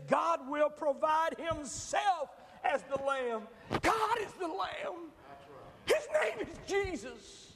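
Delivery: high, slow, shouting preacher; full-force preaching. Acted. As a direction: proclaim it, slow and soaring.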